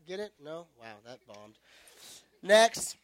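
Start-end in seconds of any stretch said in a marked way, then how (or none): none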